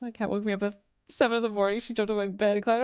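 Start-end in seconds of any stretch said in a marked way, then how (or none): none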